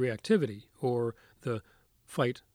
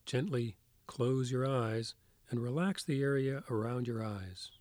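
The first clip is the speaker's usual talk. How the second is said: soft spa whisper; 4 dB quieter than usual. Acted. Leads a guided meditation.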